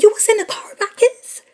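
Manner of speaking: shouted high, outraged; high loud outraged wife. Retold or imitated. Wife hearing her kids were in the car, loud and high. Wife voiced, angry question.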